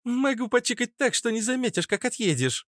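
put-on high-pitched sneering street girl; prickly redhead girl. (дерзкая девчонка, высоким голосом)

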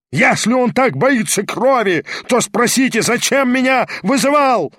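high-pitched, wailing protest; angry yelling. A narrator's reading. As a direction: yell the challenge indignantly in a high voice. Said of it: "вопил Гаганов". (гневный вопль)

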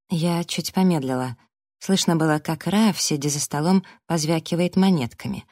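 ordinary delivery, nothing marked

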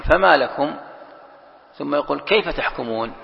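two separate phrases, a clear break between لكم and كيف; pause after لكم. Shown as a demonstration. Teacher showing the reciter where to stop, pausing after لكم. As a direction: stop fully after فما لكم, then start كيف تحكمون afresh. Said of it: "الأولى للقارئ أن يقف".

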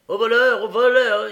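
loud high cry of stop thief; shouting thief. A narrator's reading. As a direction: yell it out to raise the chase. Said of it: "Il y a quelque chose de magique dans ce cri".

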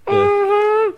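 very high falsetto yeah. Acted. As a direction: squeal it up high. Continues falsetto bit.

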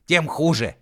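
high, loud huff; defiant retort. A narrator's reading. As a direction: throw back an indignant retort. Defiant retort before climbing into sarcophagus.

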